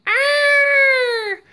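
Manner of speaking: piercing high parrot squawk; bird shriek. A narrator's reading. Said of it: "ear-splitting shriek".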